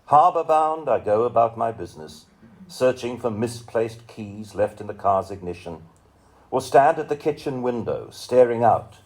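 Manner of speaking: measured, lyrical recital of a poem; poetry reading. Acted. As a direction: give each image room, read it like a poet on stage. Reads a poem aloud.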